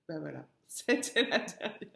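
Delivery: ordinary talk, nothing marked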